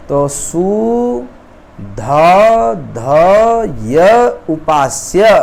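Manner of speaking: very slow, each sound separated, ध said twice; sound-by-sound demonstration. Shown as a demonstration. Teacher demonstrating doubling to students, ध pronounced twice, slowly. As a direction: spell out सु, ध, ध, य with clear pauses, then उपास्य. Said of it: "द्वित्व माने दो बार उच्चारण आप कर देंगे".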